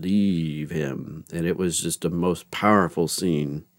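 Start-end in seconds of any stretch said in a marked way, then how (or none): 0.0-1.2 soft, understated retold command; very calm. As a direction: quote a quiet, unhurried order. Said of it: "just very calmly says".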